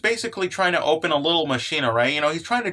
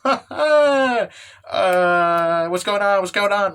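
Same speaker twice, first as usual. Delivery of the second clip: imitated fright; 2 semitones higher, 5 dB louder, slower than usual. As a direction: act out a startled, hammed-up panic. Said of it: "she is absolutely piss scared".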